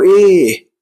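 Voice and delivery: loud, long "إيه", a stretched "eyy"; colloquial Egyptian "what?". Acted. Answering someone who calls you in Egyptian slang, long "إيه". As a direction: draw out "إيه" as if answering a call. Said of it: "فتقول له".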